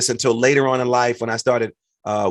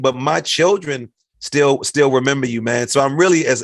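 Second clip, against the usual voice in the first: ordinary talk, nothing marked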